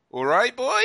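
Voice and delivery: high, loud Norfolk yokel greeting; Norfolk accent. Retold or imitated. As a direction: imitate a Norfolk local. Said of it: "you do say".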